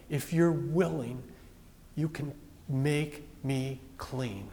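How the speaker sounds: soft, slow, humble plea; a leper on his knees begging Jesus, soft and slow; pleading. Retold or imitated. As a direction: beg quietly from your knees. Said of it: "and says".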